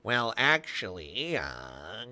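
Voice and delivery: lightly voiced nerdy well actually; know-it-all nerd voice. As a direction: play the pedantic know-it-all. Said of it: "do the know-it-all nerd voice".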